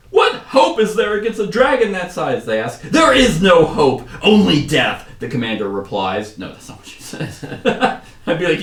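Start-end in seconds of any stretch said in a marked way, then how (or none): 0.1-2.5 loud, high-pitched cry of despair; desperate. Acted. Crowd bombarding the commander with questions, loud desperation. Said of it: "with increasing desperation in their voices". 2.8-5.1 slow, loud, high declaiming; hammed-up doom. Commander replying to the desperate crowd, mock booming doom.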